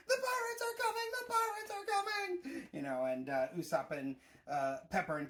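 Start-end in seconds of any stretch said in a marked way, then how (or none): 0.1-2.7 very high mock panicked cry; panicked Onion alarm. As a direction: shout the warning like a frightened kid. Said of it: "where he's running in and".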